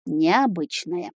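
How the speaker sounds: slow, pensive repeat; thoughtful. A narrator's reading. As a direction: draw it out, lost in thought. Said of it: "задумчиво повторил Дарк".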